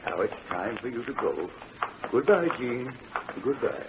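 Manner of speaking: soft, hushed reassurance to a frightened ghost; gentle comfort. Acted. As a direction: soothe her quietly, almost under your breath. Pop comforts a dead girl's ghost.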